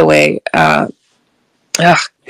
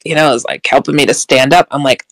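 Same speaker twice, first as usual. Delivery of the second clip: ordinary talk, nothing marked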